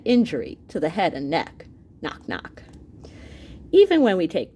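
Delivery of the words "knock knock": soft, whispered joke; whispered knock knock. Acted. Joking to kids about skulls, in a whisper. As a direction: hush it like a playful secret.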